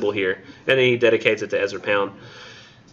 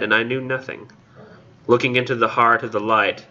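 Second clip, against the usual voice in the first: poem reading; 3 semitones higher than usual. Acted. Lecturer reading the hyacinth girl passage, poem reading. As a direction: recite it in spaced, deliberate phrases.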